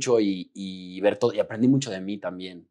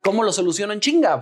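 high, louder frantic demand; desperate impatience; 8 semitones higher, 5 dB louder than usual. Retold or imitated. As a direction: act out an urgent need to fix it. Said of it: "está desesperado". (desesperación impaciente, voz aguda y fuerte)

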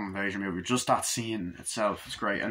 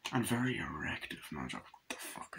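much softer, lower throwaway pun; muttered joke; 7 semitones lower, 13 dB quieter than usual. Real, not acted. Vlogger mutters a cheeky pun, much softer. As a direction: mumble the gag under your breath. Self-mocking joke aside.

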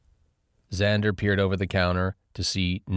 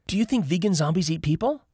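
Lauren's voice; 12 semitones higher, faster than usual. A narrator's reading. Lauren asks, high.

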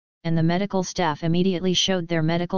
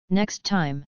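bitter. Acted. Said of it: "the doctor said bitterly".

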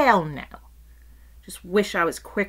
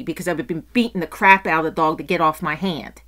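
normal talking voice

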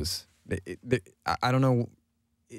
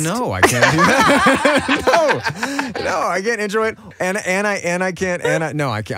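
laughing; 9 semitones higher, 7 dB louder, slower than usual. Real, not acted. Can you intro it for us.

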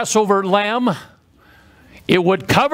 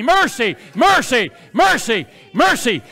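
higher, rhythmic cries, each falling; preached cry of mercy; 4 semitones higher than usual. Shown as a demonstration. Preacher getting the congregation to say it with him, insistent chant. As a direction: lead the crowd in a repeated chant. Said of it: "Say it with me".

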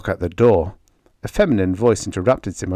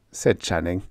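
usual speaking voice

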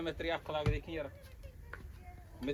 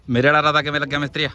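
louder, boasting to the mason; loud proud call; 14 dB louder, faster than usual. Real, not acted. Proudly asks mason loudly. (ਉੱਚੀ ਆਵਾਜ਼ ਵਿੱਚ ਮਾਣ ਨਾਲ)

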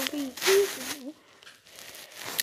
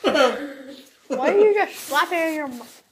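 laughing; 7 semitones higher, 12 dB louder than usual. Real, not acted. Laughing at friend's sour reaction.

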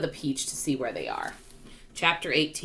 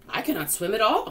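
mildly alarmed, pitched up; worried cry; 6 semitones higher, 3 dB louder than usual. A narrator's reading. Ladybug admits to the others, worried cry. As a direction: cry it with a touch of worry. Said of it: "cried the ladybug".

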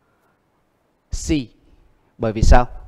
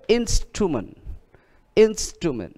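instrument with a high, falling IN; INS-trument, pitched up then falling; stress on first syllable; 7 semitones higher, slower than usual. Shown as a demonstration. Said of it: "được nhấn trước nó hai âm".

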